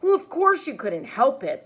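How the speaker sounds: mother gently scolding. A narrator's reading. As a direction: mom scolding her younger son. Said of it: "Mom scolds".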